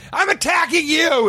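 very high, strained attack cry; mock attacker's shout. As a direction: shout it like a fake assailant. Voices the staged attacker.

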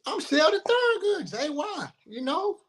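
high-pitched cocky boast; mock cocky. Player mimicking his younger cocky self to the host. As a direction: puff up like a star who deserves minutes.

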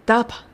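ダパ with ダ strong and パ weak; stress on da. Shown as a demonstration. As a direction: hit ダ, let パ fall away. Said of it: "ダを強く、パを弱く発音します".

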